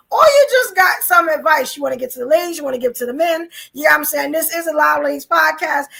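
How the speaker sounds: ordinary delivery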